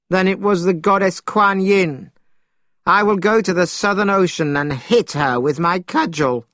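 high-pitched, vengeful outburst; worked-up, angry declaration; indignant threat. A narrator's reading. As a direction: vow revenge with the pitch lifted. Monkey vows to hit the goddess.

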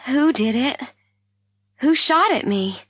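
high-pitched, alarmed questions; urgent demand. A narrator's reading. Wounded patient asking the doctor who shot her, urgently. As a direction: press urgently for the answer.

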